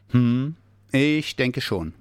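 slow, pondering bear; thoughtful. A narrator's reading. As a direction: weigh it up slowly. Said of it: "sah ihn nachdenklich an". (nachdenklich, langsam)

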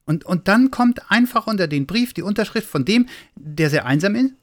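a hint of a curious little boy asking; little Weihnachtsmann. A narrator's reading.

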